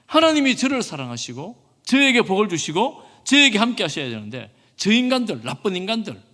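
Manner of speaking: loud, high-pitched indignant complaint; 요나의 원망. Acted. 요나가 하나님께 따지는 장면. (목소리 높여 원망하듯)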